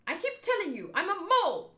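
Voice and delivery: high, loud hammed-up protest; exasperated insistence. Mole woman corrects the penguin remark, acted exasperated protest.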